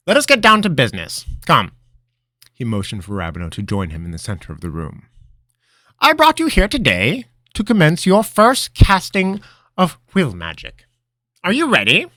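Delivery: high, loud mentor voice; old wizard mentor. Acted. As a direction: command like a wizard master. Master starts the lesson.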